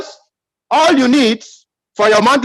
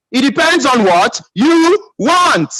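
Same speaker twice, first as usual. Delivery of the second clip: drawn out word by word, pitch raised; slow emphasis; 5 semitones higher, slower than usual. Real, not acted. Speaker drives the point home to the audience, slow and emphatic. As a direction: spell it out slowly and deliberately.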